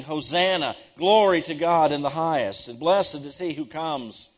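ringing preacher's rendition of a crowd's acclaim; mock crowd shout. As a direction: proclaim it loudly like a cheering crowd, short of a full shout. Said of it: "rather than shouting".

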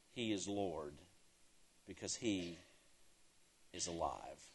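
hushed, weighty preacher's climax; hushed reverence. Real, not acted. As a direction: drop low and quiet, with long pauses. Hushed climax after repeated shouts.

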